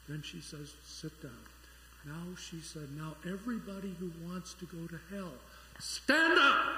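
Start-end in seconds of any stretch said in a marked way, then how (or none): 0.8-5.3 hushed pulpit voice, well under normal volume; very quiet minister. Acted. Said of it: "very quietly". 5.8-6.7 loud, high command to wake a sleeper; abrupt full-voiced yell; sudden shout. Minister startles the sleeping man awake.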